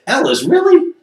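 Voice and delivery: high incredulous really; exasperated really. Retold or imitated. Telling Ellis off, high and incredulous. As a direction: say it like you can't believe him.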